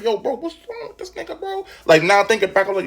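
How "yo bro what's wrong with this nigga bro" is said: high, soft disbelief; incredulous kid. Retold or imitated. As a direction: ask it high and baffled under your breath.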